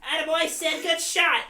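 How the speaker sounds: high, sarcastic cheering; mock Kessel coaching. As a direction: cheer them on in his voice. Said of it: "Can you imagine Phil Kessel".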